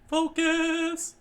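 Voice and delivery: very high, loud squeak; falsetto shout. Acted. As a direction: squeal the order.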